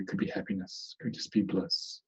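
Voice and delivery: soft breathy whisper on the last feelings. Acted. Teacher to meditators, naming bliss in a whisper. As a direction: let the words fade into a hush.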